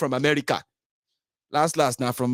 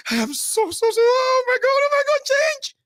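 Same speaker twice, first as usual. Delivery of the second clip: very high, loud ecstatic squeal; 4 dB louder than usual. Retold or imitated. Mocking a fan over change, ecstatic squeal. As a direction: squeal with overwhelming joy.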